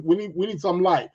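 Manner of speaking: ordinary talk, nothing marked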